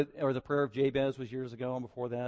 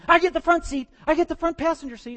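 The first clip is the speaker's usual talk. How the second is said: put-on much higher, louder childish shout; child claiming seat; 12 dB louder than usual. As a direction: kid yelling to claim the front seat. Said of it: "they run out and grab".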